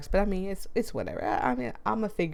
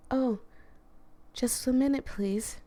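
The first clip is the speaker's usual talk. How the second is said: higher-pitched, mellow woman's voice; smooth, mellow character; 6 semitones higher than usual. Acted. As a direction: answer the door in a smooth, higher tone. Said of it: "a smooth, mellow-toned voice".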